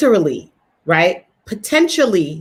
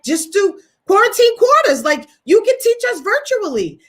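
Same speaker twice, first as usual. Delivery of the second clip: much higher, bright eager urging; eager suggestion; 11 semitones higher, faster than usual. Retold or imitated. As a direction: pitch the idea with sudden excitement, much higher. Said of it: "I was like".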